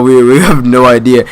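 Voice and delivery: ordinary talk, nothing marked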